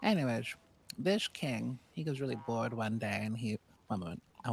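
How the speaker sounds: usual speaking voice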